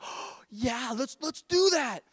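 put-on slow, high-pitched eager kid agreeing; brightened-up child. Three-year-old son agreeing to help, excited. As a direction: imitate an excited little boy, gasping then rising high. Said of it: "his face brightened up".

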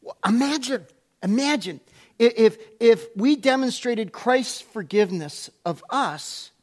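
ordinary talk

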